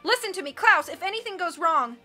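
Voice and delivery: loud, urgent appeal; urgent warning. A narrator's reading. Captive urgently warns his friend.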